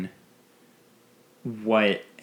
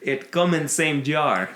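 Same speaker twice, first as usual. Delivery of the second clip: put-on slow, hammy Russian accent; 5 semitones higher, slower than usual. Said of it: "My, my Russian accent stems".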